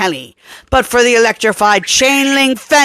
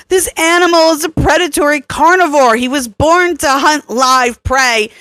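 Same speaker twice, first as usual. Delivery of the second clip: put-on higher, insistent young woman arguing, faster at the start; Kelly, urgent; 9 semitones higher than usual. A narrator's reading. Kelly lecturing Tarosh about the predator's nature, urgent. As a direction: press the point hard and quick.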